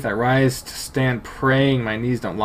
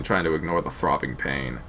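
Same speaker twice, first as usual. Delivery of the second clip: plain reading voice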